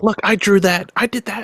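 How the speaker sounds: proud artist voice. Retold or imitated.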